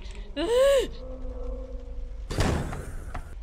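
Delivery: high startled gasp; frightened intake of breath; sharp gasp. Real, not acted. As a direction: gasp at a sudden shock. Sudden scare.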